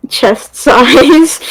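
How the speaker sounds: laughing through the words; a laugh breaking into the phrase; amused. Real, not acted. Amused at weird comment.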